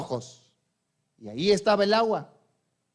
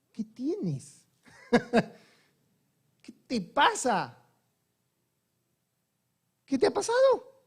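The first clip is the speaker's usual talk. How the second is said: put-on questioning voice, climbing high by the end; acted angel's question; 4 semitones higher than usual. Preacher as the angel calling to Agar from heaven, put-on questioning voice. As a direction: play the angel gently quizzing, rising each time. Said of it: "llamó a Agar desde el cielo y le dijo".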